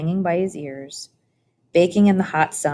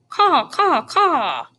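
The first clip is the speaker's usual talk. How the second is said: high, harsh crow caw; 10 semitones higher, 4 dB louder, much slower than usual. A narrator's reading. Crow screams a dark message into Edward's ear, harsh cawing.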